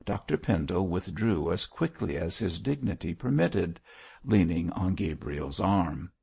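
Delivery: ordinary delivery, nothing marked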